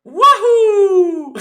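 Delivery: very high, loud cartoon whoop; Mario. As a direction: let out a huge game-character cheer. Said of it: "Why are you doing that voice?".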